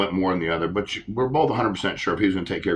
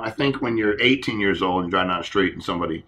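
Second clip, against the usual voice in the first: normal talking voice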